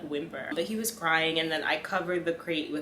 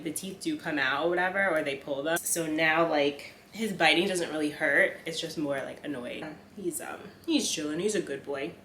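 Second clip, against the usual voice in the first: ordinary talk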